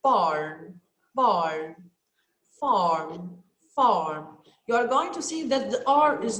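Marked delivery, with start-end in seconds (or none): 0.0-4.4 "barn" and "farm" said low and clear, R kept light; teacher modelling AR words slowly; careful word model. Shown as a demonstration. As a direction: drill each word twice for the class. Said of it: "let's see the A R, like".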